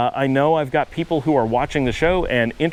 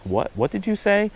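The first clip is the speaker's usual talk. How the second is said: higher-pitched mock confusion; retold puzzled question; 7 semitones higher than usual. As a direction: imitate baffled listeners, pitched up. Retelling puzzled listeners' reaction.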